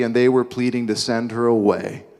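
ordinary delivery

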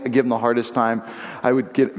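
ordinary talk

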